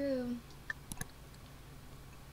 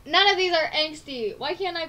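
mock outrage; 4 semitones higher, 9 dB louder than usual. Real, not acted. Mock complaint.

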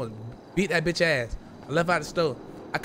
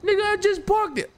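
very high mocking whine; mocking the rival's whiny excuse. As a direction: squeak it like a lame excuse.

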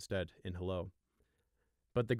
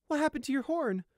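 high, shocked question; surprised gasp; 6 dB louder than usual. Acted. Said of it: "Gossamer gasped in surprise".

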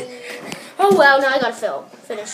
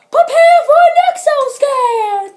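hyped toy announcement; 11 semitones higher, 7 dB louder than usual. Pretend. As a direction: build to a high, loud shout. Announcing toy's scan feature.

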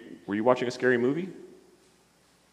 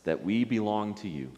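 lower, soft prayer lines for others to repeat; 4 semitones lower, 7 dB quieter, slower than usual. Acted. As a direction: lead a gentle prayer phrase by phrase. Leads prayer for repetition.

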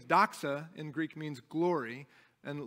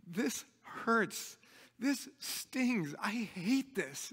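higher, pained "This hurts", slowing on "I hate this"; pained honesty; 4 semitones higher, much slower than usual. Retold or imitated. Pastor voicing a hurting believer, pained and higher.

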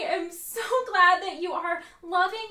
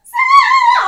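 very high, loud held cries, building; theatrical belted cry; 17 dB louder, much slower than usual. Acted. Acting student showing off Zoom, hammed-up soaring cries. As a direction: belt each one bigger than the last.

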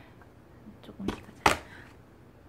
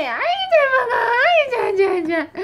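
much higher and louder, delighted; excited praise; 12 semitones higher, 10 dB louder than usual. Real, not acted. Mother cheering her baby for eating, high and loud.

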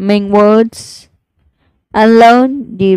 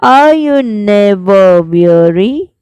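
slow, sing-song recital with rising line ends; poem recitation; 3 semitones higher than usual. Shown as a demonstration. Teacher reciting the lark poem for students, slow sing-song. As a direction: recite it like a class poem, each line lingering.

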